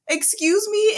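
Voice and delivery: high, stunned "excuse me"; shocked disbelief. Retold or imitated. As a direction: gasp it out in disbelief. Shocked class reacting to teacher.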